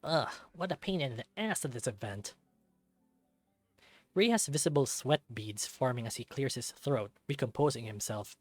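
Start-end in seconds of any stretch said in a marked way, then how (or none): none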